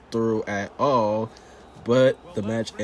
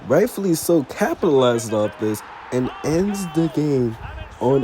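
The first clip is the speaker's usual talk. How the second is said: excited play-by-play; 3 semitones higher, 5 dB louder than usual. Acted. Narrating penalties and golden goals to viewers, excited play-by-play. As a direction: call the goals like a live commentator. Calls the simulated matches.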